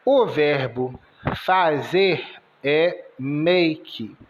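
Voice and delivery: normal talking voice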